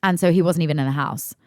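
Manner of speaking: ordinary talk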